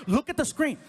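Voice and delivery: loud, high-pitched insistent order; agitated yelling. Retold or imitated. Man yelling for his girlfriend to read the email, agitated. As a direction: yell it, cutting across the other person. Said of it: "I started yelling at the screen".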